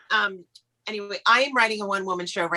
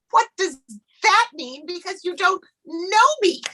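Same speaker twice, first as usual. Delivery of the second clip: exasperated; 10 semitones higher than usual. Retold or imitated. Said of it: "I'm like".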